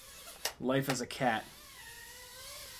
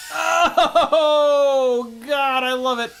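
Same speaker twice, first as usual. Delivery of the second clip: loud, high laughing whoop; thrilled delight; 11 semitones higher, 8 dB louder, much slower than usual. Real, not acted. Pilot thrilled by his power loop, loud elated cry. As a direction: crow with delight, stretching the first word.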